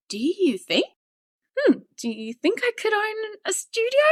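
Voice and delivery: high, hesitant daydreaming question; wondering. Retold or imitated. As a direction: muse as if barely daring to hope. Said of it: "And I was like".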